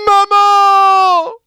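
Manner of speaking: very high, loud scream for mum; panicked child calling out; terrified scream. Acted. (hurlement terrifié d'enfant, très aigu et fort)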